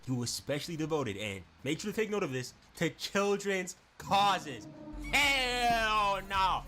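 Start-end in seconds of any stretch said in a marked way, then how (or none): 2.8-6.6 slow, high, louder dread. Real, not acted. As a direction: stretch it in dread. Reacting to children line.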